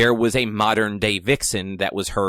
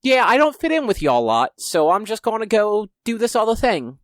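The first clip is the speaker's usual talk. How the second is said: higher-pitched, hammed-up British accent; bad British accent; 10 semitones higher, 3 dB louder than usual. Host imitating John Constantine's exit from the show, bad British accent. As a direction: put on a terrible British accent, voice lifted. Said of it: "That's a really bad British accent".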